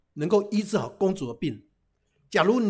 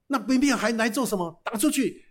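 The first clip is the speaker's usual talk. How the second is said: angry guard; 5 semitones higher, 5 dB louder than usual. Acted. Guard chasing off three brothers.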